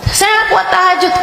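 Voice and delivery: high, whining plea; whiny complaint. Acted. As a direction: whine like a tired believer. Voicing a congregant's complaint. (keluhan merengek dengan suara tinggi)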